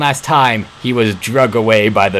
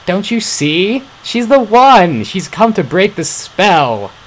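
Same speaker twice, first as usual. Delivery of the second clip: excited; 9 semitones higher, 4 dB louder, slower than usual. Acted. Excited about the girl.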